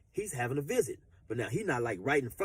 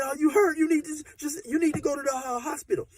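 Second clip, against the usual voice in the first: very high, loud anxious plea; worried urging; 6 dB louder than usual. Retold or imitated. Girlfriend urging him to see a doctor, anxious and high. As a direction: press him to get help.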